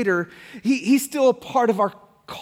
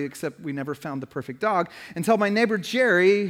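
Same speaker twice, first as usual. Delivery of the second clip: normal talking voice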